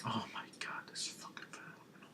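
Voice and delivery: hushed, voiceless amazement; barely audible, breath-only awe; awed whisper. Retold or imitated. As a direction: whisper it as if stunned. Acts out awed reaction.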